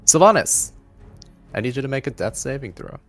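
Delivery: normal talking voice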